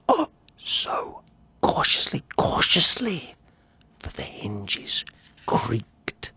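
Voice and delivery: soft whisper; tense, whispered caution. Acted. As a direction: hush every word. Undoing the lantern at midnight.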